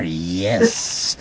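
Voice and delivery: low, soft, drawn-out gloating; hammed-up sinister purr; sinister villain. A narrator's reading. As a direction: savor it like a shadowy mastermind. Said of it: "in a most sinister manner".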